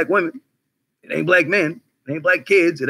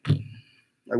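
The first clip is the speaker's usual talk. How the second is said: whispered; 5 semitones lower, 9 dB quieter than usual. Real, not acted. Whispered aside between two whats.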